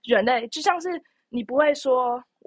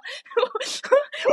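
high laugh; 14 semitones higher, 4 dB louder than usual. Real, not acted. Laughing fit mid story.

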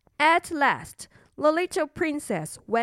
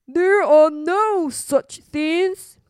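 loud, slow, higher insistence; emphatic king father; 4 semitones higher, 7 dB louder, much slower than usual. Acted. King creeping out, insisting monsters don't exist, emphatically. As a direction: insist firmly, stretching the words.

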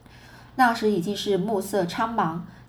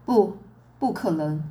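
slow, cold voice; 2 semitones lower than usual. A narrator's reading. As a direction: say it coldly and sternly. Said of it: "妈妈用缓慢而冷峻的声音说".

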